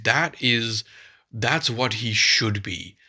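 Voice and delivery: ordinary talk, nothing marked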